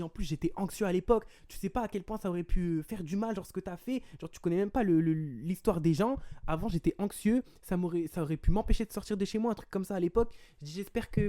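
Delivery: high, insistent retold rant; worked-up reproach. Telling her how much harm it could do, agitated reproach. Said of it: "j'étais trop énervé".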